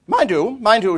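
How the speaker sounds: high, loud, emphatic qualifier; imitated brisk countrywoman; English countrywoman. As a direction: imitate a formidable country lady. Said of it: "archetypal English countrywoman".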